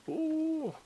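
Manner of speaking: sudden high yelp; surprised yelp. Real, not acted. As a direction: yelp as you slip. Falls off the stairs.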